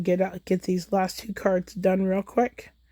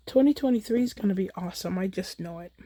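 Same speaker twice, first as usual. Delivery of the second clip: ordinary talk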